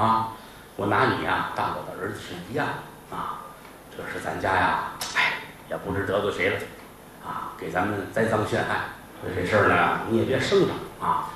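low, hushed talk of a scheming master; soft, fatherly persuasion hiding a plot; confiding shopkeeper. Acted. Boss's long quiet, confiding speech.

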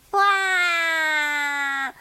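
put-on high loud baby wail; loud wailing cry. A narrator's reading. Narrator wails the crying heard outside the window. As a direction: wail out like a sobbing child.

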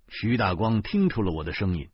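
usual speaking voice

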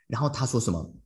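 ordinary talk

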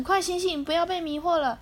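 high urgent shout. Acted. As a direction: call out to wake them. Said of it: "大喊一声".

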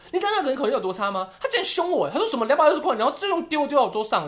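high, fast, indignant complaining; mock angry dad rant. Daughter imitates dad griping about rude cashier, high and indignant. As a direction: squeal it like an offended father.

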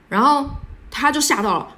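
ordinary talk, nothing marked

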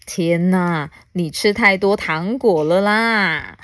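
ordinary delivery

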